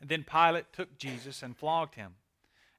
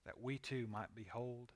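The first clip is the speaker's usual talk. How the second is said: reverent prayer; 4 semitones lower, 7 dB quieter than usual. Real, not acted. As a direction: pray quietly, phrase by phrase. Leads a closing prayer.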